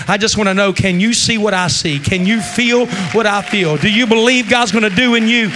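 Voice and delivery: rally the room; loud preaching call. Real, not acted. Preacher rallying the congregation to believe, loud.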